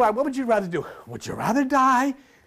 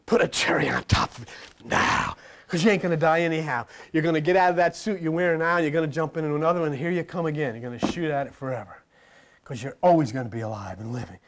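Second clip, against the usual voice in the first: low, rolling preacher voice, quick in the middle; Reverend Ike; 9 semitones lower, 3 dB quieter, faster than usual. Retold or imitated. Preacher quoting a famous evangelist to the congregation, low and rolling. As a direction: drop low and roll it out like a showman preacher. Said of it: "Reverend Ike said".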